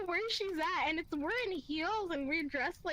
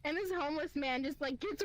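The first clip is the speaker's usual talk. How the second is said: ordinary talk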